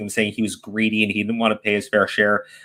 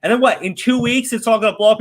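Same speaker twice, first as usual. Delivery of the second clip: usual speaking voice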